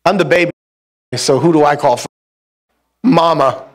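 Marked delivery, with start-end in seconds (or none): none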